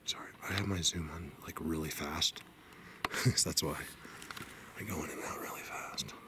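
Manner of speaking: very soft, low murmur; hushed aside. Real, not acted. Vlogger explaining the zoom to viewers, hushed. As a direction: keep it down, nearly whispering.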